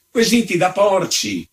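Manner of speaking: high-pitched indignant exclamation; outraged outburst; blustering. A narrator's reading. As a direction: throw it back in scandalized outrage. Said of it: "strepitò il visitatore".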